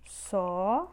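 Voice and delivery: "Sótão" split into SÓ, long pause, TÃO; syllable by syllable. Shown as a demonstration. Teacher separating the word for students, syllable by syllable. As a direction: hold SÓ, pause, then say TÃO. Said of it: "Separamos dessa forma".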